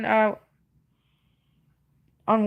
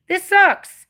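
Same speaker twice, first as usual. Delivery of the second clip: bitter; 8 semitones higher, 6 dB louder than usual. Real, not acted. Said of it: "I sound like a bitter bitch".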